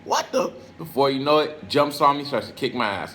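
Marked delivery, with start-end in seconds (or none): 0.0-0.5 high startled what the; stunned. Retold or imitated. Himself when the kid jumps out, high startled gasp. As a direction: caught off guard, pitch jumps up. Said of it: "I'm like".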